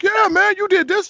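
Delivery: very high, loud, eager; retold eager praise. [name] recalling the actor's videos, very high and eager. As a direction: burst out eagerly.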